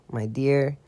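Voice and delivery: ordinary delivery, nothing marked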